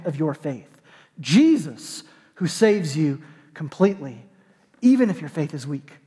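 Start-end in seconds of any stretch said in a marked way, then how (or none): none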